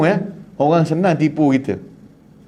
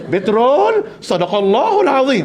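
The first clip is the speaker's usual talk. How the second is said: confident pilgrim; 7 semitones higher, slower than usual. Retold or imitated. Pilgrim misusing Quran phrase.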